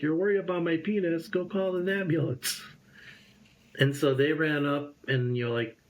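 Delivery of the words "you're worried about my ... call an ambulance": high, exasperated comeback; mock disbelief, pitched up; incredulous retort. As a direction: scoff at the friend, call for help. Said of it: "And I'm like".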